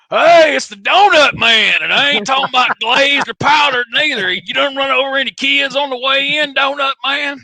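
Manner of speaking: high, loud teasing holler; mocking Tommy Rich. Veteran wrestler ribs him as the donut man at every show, high and loud. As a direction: holler the nickname like a ribbing old-timer.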